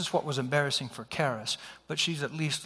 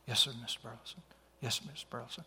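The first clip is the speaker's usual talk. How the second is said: low, hushed, submissive reply; imitating an intimidated young man, very soft; meek boyfriend; 4 semitones lower, 10 dB quieter, faster than usual. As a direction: mumble a cowed yes to her father. Frightened boyfriend submits to the father.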